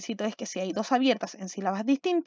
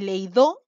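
leído with the final "do" stressed; lei-DÓ, trying out a wrong stress; wrong stress, last syllable; 4 semitones lower, 5 dB louder than usual. Shown as a demonstration. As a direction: push the weight onto the end of leído.

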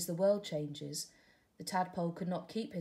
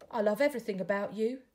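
tadpole in love; 3 semitones higher, 7 dB louder, faster than usual. Acted.

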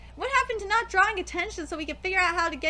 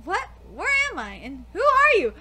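ordinary delivery

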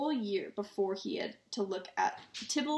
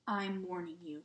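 hushed, slightly lowered threat; low warning; 3 semitones lower, 6 dB quieter than usual. A narrator's reading. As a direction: warn the boy under your breath. Uncle threatens nephew before outing.

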